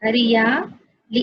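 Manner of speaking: हरिया-ली said very slowly, split into हरिया and ली; teacher dictating हरिया-ली slowly for the children; slow spelling out. Shown as a demonstration. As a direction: stretch हरिया-ली syllable by syllable as you write it. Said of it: "देखिए मैं आपको दिखा रही हूँ".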